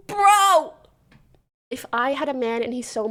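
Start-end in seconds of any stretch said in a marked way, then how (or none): 0.1-0.7 loud, high, drawn-out cry; exasperated shout. Real, not acted. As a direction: yell it in disbelief. Exasperated outburst at the flirting.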